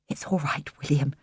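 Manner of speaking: breathy whisper, no voice; whispered back. A narrator's reading. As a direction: whisper it back close to his ear. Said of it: "Jilly whispered back".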